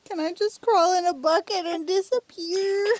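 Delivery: high, loud mock wail of embarrassment; mock despair. Teased streamer wishing to vanish, hammed-up despair. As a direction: wail as if mortified, playing it up.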